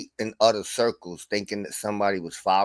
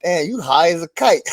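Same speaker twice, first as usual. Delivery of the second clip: mocking taunt; 6 semitones higher, 6 dB louder than usual. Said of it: "I used to go in there like".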